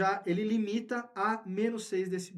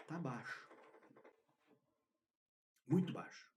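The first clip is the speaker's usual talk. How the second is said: very quiet; 3 semitones lower, 12 dB quieter than usual. Shown as a demonstration. Showing listeners a too-low microphone level, very quiet. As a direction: voice dropped right down. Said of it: "eu vou baixar o meu tom de voz".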